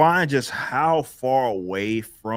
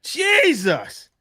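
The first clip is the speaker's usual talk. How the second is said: very high, drawn-out cry of disbelief; astonished exclamation; 4 dB louder than usual. Real, not acted. Host floored by fifty gifted memberships, astonished exclamation. Amazed at gifts pouring in.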